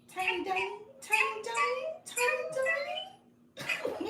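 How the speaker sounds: very high squeaky chipmunk effect. Shown as a demonstration. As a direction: repeat it in a tiny, sky-high chipmunk voice. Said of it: "this says Chipmunk, y'all".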